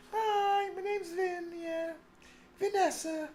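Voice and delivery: high mincing falsetto introduction; mock girly voice. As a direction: play Vin as a girly Vanessa.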